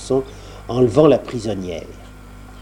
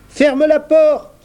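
high slow voice; 6 dB louder, slower than usual. A narrator's reading. Salive voice repeated.

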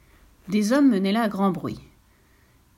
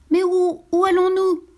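high, loud worried question; startled at the sudden speed; alarmed question; 10 semitones higher, 5 dB louder than usual. Acted. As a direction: Perlette asking as the current speeds up. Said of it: "demanda-t-elle".

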